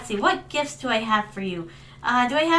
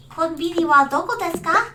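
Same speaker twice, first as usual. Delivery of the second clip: higher-pitched anime-style Japanese accent; 4 semitones higher than usual. Shown as a demonstration. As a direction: ask it in a Japanese accent, lifting the end. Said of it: "I can do a Japanese accent".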